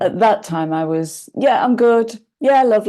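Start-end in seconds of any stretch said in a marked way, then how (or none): 1.3-2.9 bright and upbeat, higher and louder; breezy polite cheer; chirpy. Retold or imitated. Usual chirpy reply to cashier.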